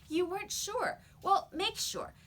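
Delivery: put-on a girl insisting John bite the coin; insistent girl. A narrator's reading. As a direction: press him to do it.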